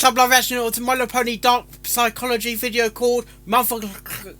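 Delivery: ordinary talk, nothing marked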